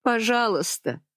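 high, begging please; pleading. A narrator's reading. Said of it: "умоляла Генюль". (умоляюще)

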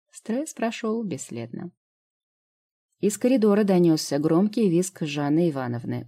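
plain reading voice

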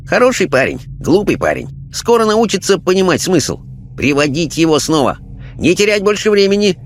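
high-pitched, sing-song Chinese guide; Chinese spirit guide. Acted. Chinese guide sending the medium's spirit onward, quaint and high. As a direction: pronounce it high, like an odd little sage. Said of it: "китаец с причудливой улыбкой".

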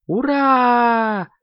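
long, high-pitched hurrah; joyful cheer. A narrator's reading. Kittens overjoyed to get the phone, shouting a high hurrah. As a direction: cheer with the word stretched out high. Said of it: "хором закричали котята".

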